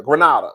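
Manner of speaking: Grenada with AH in the middle, gruh-NAH-duh; "Grenada" with an open "ah", pitched low; chosen pronunciation. Shown as a demonstration. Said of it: "Is it Grenada or Grenada?".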